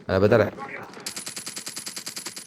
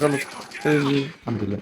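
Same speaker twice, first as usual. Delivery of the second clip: slow relieved; 4 dB quieter, much slower than usual. Real, not acted. Relief after surviving fight.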